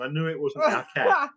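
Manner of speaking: normal talking voice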